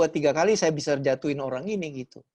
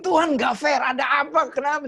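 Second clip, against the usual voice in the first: much higher, louder shouted protest; angry complaint; 9 semitones higher, 4 dB louder than usual. Retold or imitated. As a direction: yell at God that it's unfair. Complaining angrily to God.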